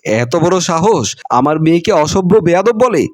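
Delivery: usual speaking voice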